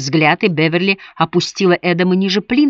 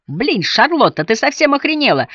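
angry; 8 semitones higher than usual. Acted. Roommate furious at being woken.

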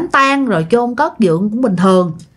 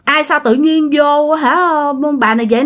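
normal talking voice

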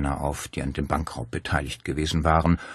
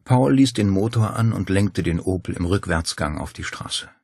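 ordinary delivery

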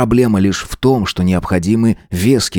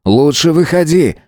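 calling out; 8 semitones higher than usual. A narrator's reading. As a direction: shout a warning into the woods. Calling out to hidden presence.

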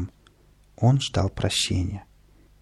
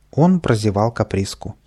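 ordinary delivery, nothing marked

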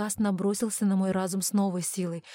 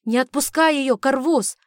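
high, loud call; alarmed, raised-voice order; urgent shouted order; 5 semitones higher, 8 dB louder than usual. Acted. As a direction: shout a command across the room. Urgent order during a struggle.

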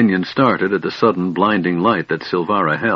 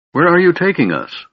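insistent, raised question; insisting; 10 semitones higher than usual. A narrator's reading. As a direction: press her for an answer. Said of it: "Lorana insisted".

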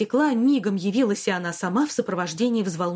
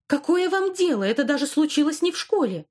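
defiant outburst; 7 semitones higher than usual. Acted. Defiant girl snaps at headmistress.

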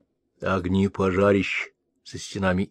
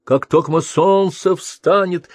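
a lifted, higher, louder promise; light up with sudden hope; inspired; 7 semitones higher, 4 dB louder than usual. Acted. Prince promising to comfort his mother, inspired. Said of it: "Князь было вдохновился".

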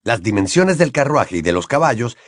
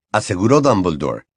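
usual speaking voice

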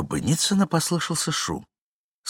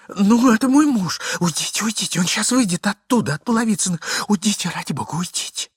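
pleading lady; 12 semitones higher, 5 dB louder than usual. Acted. Lady urges lover to leave.

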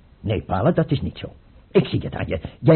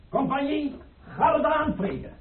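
drawn-out, high-pitched parade-ground calls; the tsar playing drill sergeant with his imaginary company, calling high and drawn out; drill commands; 12 semitones higher, 7 dB quieter than usual. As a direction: bark orders at imaginary troops, slow and high.

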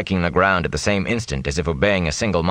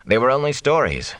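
usual speaking voice

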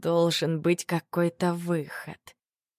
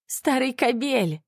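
slow, high, bitter cry; angry outburst; 7 semitones higher, slower than usual. A narrator's reading. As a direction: spit out the insult in tears. Mother insults her drunk lover.

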